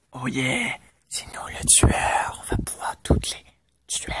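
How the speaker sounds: breathy villain whisper, gloating; sinister whisper. Acted. Gamer gloating to viewers about being the killer, whispered.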